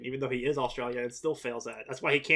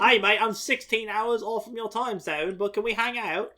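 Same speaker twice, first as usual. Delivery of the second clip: slow, higher Australian accent, hammed up; 8 semitones higher, 4 dB louder than usual. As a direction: put on a cheesy Australian accent. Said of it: "That was an Australian".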